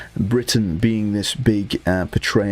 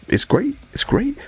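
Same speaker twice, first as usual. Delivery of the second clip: squeaky praise. Real, not acted.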